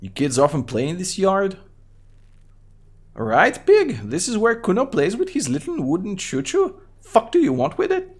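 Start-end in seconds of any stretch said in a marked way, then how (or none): 3.2-8.1 put-on high-pitched mouthy kid voice; Kuno voice. Kid Kuno mocks the cop, high bratty kid voice. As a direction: voice a rude little brat up high, talking about himself in third person. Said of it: "What is wrong with your high voice?".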